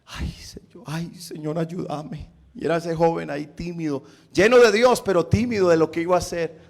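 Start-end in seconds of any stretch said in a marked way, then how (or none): 0.0-2.3 timid, whispered plea for help; hushed, timid prayer under the breath. Retold or imitated. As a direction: whisper a shy little prayer, voice very small. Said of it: "como con esa timidez".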